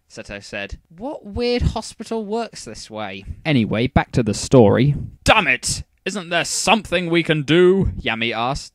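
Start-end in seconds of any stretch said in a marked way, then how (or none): 0.9-3.3 slow sarcastic aside. Acted. 5.2-7.9 loud, desperate cry; desperate outburst. A narrator's reading.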